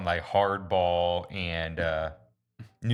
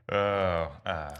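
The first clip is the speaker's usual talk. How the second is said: ordinary delivery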